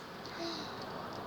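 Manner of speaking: breathy awed gasp; whispered gasp. Acted. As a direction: gasp at the crown. Sight of prince's crown.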